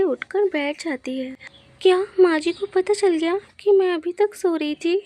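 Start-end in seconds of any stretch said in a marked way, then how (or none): 1.8-5.0 alarmed question. Acted. Wife woken, alarmed at being found out.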